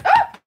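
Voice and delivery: sudden loud, very high "oh!"; startled yelp. Real, not acted. Presenter drops something on the ground, startled yelp. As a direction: yelp as something slips from your hands. Drops something mid-sentence while showing deck.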